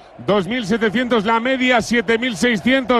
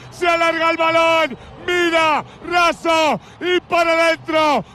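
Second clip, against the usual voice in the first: breakneck goal commentary; 6 semitones higher than usual. Real, not acted. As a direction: roar the goal like a live commentator. Live goal call by commentator.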